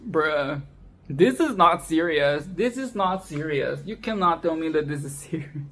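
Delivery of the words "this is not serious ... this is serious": ordinary delivery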